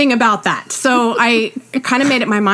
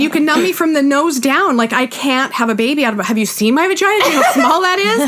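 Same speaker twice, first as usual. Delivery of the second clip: rising higher and laughing by the end; high comic pleading; 6 semitones higher than usual. Retold or imitated. Pleading with the doctor.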